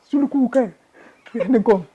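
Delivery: can't believe she changed her mind; high, stunned complaint; incredulous. Retold or imitated. Disbelief at wife's reversal.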